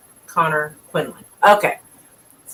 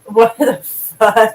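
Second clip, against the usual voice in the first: drawn-out disbelief; 7 semitones higher, 8 dB louder, much slower than usual. Real, not acted. Shocked reaction to squirrel idea.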